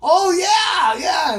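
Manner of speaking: put-on boy shouting with glee; excited shout. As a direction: blurt it out, thrilled. Grandson eager to meet friends.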